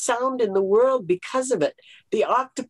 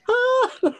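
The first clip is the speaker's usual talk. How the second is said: high-pitched "ah" breaking into laughter; high mock exclamation. Speaker mocking how the changed names strike her, high laughing exclamation. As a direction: let out a very high, drawn-out ah.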